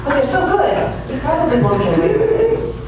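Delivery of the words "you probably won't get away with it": ordinary talk, nothing marked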